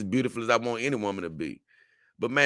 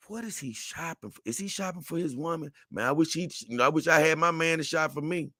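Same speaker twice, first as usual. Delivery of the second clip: higher-pitched, hushed imitation of curious women; wondering women shoppers; 6 semitones higher, 4 dB quieter than usual. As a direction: imitate women murmuring wistfully among themselves, pitched up. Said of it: "some of 'em looking at like".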